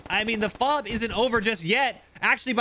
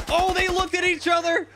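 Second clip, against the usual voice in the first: excited call; 8 semitones higher than usual. Real, not acted. Caster sees sudden close encounter.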